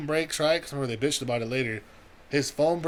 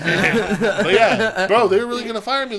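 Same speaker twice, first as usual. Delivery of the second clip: laughing; 6 semitones higher, 9 dB louder than usual. Real, not acted. Laughing after the friend's laugh.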